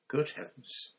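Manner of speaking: whispered, breathy shock; shocked gasp. A narrator's reading. McGonagall staring down at the man, whispered shock. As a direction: gasp it under your breath. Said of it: "said Professor McGonagall".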